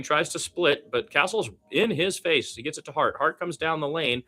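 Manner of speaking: lively live game commentary, pitch jumping with the action; play-by-play call. Acted. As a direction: call the game as it happens for the listeners.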